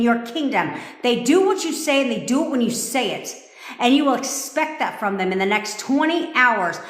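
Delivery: loud, high, ranting coach sermon; loud preaching rant that builds; fired-up preaching. Real, not acted. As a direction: whip the crowd up, building and building. Preaching at full force.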